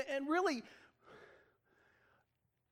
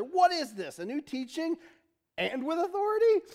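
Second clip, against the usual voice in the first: raised pitch, astonished questioning; amazed; 7 semitones higher, 4 dB louder than usual. A narrator's reading. People asking each other about Jesus, amazed. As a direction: ask it in wonder, climbing higher. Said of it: "all so amazed they asked each other".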